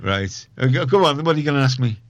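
ordinary talk

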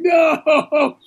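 long, high comic no; mock wail. Host retelling reaction to pastor's rain talk, high mock wail. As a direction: wail it high, drawn out, playfully.

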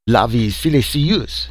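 mild shouted outburst in French; excited shout. A narrator's reading. French scientist seizing on silicon life, shouting. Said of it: "shouted Leroy".

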